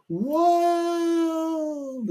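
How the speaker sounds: high, long drawn-out crowd cheer. Acted. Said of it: "And the crowd would go".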